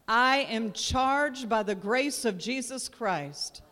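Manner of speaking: loud, slow, ringing proclamation; declaring with conviction. Shown as a demonstration. Pastor leading the church in a loud faith declaration. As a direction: preach each line to the rafters. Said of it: "say it like you mean it".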